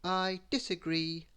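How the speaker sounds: muddled figure, drawing the words out; Mister Muddle. A narrator's reading. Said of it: "said Mister Muddle".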